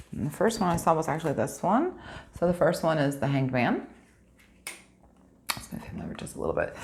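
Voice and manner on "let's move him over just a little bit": fast, soft whisper to herself; whispered aside. Real, not acted. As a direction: murmur it under the breath, quickly. Whispered aside moving a card.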